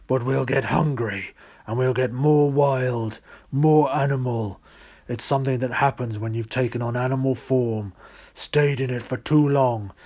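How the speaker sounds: gruff bear. Acted. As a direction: grumble out the tale like a wronged bear. The bear explains.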